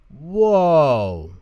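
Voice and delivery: loud high yelp of surprise; surprised whoa. A narrator's reading. Tommy sliding down the mountain, loud surprised yelp. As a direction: cry out while slipping.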